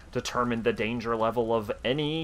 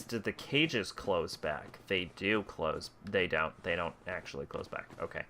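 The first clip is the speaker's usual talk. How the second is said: quiet; 4 semitones lower, 6 dB quieter than usual. Real, not acted. Checking cages, voice drops.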